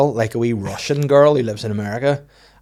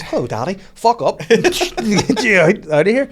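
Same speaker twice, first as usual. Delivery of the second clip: put-on high, loud, laughing horror kid; a spooky little girl gone rude; mock creepy child; 9 semitones higher, 5 dB louder than usual. Creepy daughters at the bedroom door, mock horror child voice.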